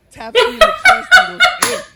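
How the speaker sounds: high, loud, breaking into laughter; can barely get it out for laughing; laughing narration. Real, not acted. Comic recalling his friend's move, laughing hard. Telling it, cracking up.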